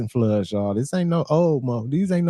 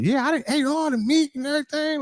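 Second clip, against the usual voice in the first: higher and quick mocking voice of the woman; faster than usual. Streamer mocks the woman who ate the meat, high quick mocking voice. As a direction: rattle it off high and fast, making fun of her. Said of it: "And you talking about".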